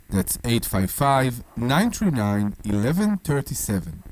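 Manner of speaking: slow, low announcer voice; announcer read. Acted. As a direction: read the number like a radio ad. Repeats number.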